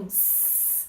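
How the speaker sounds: hissed voiceless S sound, no voice; just air through the teeth, the S. Shown as a demonstration. Teacher shows a voiceless hiss to her class. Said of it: "Então, por exemplo".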